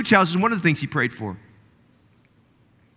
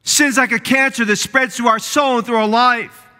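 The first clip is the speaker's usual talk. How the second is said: ordinary talk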